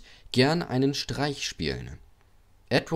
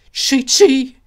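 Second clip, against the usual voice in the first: high, loud engine whistle; little engine whistling; 11 dB louder than usual. A narrator's reading. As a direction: toot like a steam whistle. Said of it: "Thomas pfiff".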